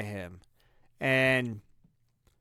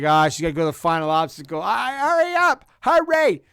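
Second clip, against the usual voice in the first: frantic urging; 3 semitones higher, 7 dB louder than usual. Retold or imitated. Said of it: "And she's like".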